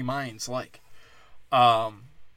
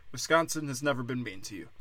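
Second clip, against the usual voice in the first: ordinary delivery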